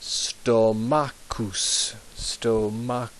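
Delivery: very slow Stomachus with hard k; slow model word. Shown as a demonstration. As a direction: stretch out Stomachus. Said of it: "guess what this word means".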